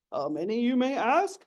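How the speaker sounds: ordinary talk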